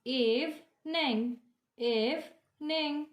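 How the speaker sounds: EVENING slowly broken in two, eve-ning, each time; split into syllables. Shown as a demonstration. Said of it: "there are two syllables".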